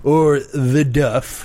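high, very slow goofy drawl; cheesy TV character. Pretend. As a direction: stretch it out like a sitcom dude. Adds to the character bit.

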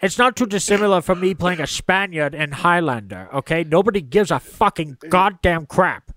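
indignant Scottish star rant; Sean Connery. Acted. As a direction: brush off critics as a sweary Scottish actor. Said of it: "Sean Connery couldn't either".

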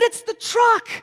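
high, loud excited call; startled cry of recognition; excited shout. Retold or imitated. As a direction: yell it out as you jump up. Shouting on spotting the truck.

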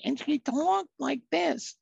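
high, squeaky, slow mock voice; squeaky counselor voice. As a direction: imitate a squeaky-voiced older woman, slowly. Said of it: "she had a voice that was squeaky".

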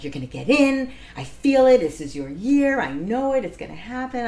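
high, loud, sure-of-it excitement; excited sister. Retold or imitated. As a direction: gush like a sister who just knows.

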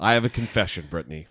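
low, grave confession; mock-serious, dropping to a hush; solemn. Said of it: "You sound solemn".

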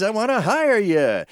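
usual speaking voice

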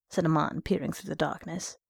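ordinary delivery, nothing marked

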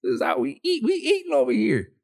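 high gleeful taunting employee, laughing; gleeful worker. Retold or imitated. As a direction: crow like a worker living large. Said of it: "I'd be like".

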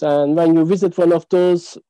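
normal talking voice

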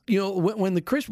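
normal talking voice

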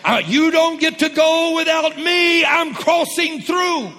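high, loud, slow declaration; triumphant shout. Real, not acted. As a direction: declare it like a victory cry.

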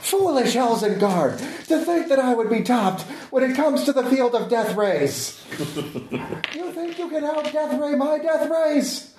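high, hammy villain gloating; slow theatrical villain menace. Acted. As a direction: gloat like a cartoon villain, laughing. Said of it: "I have to reverse monologue".

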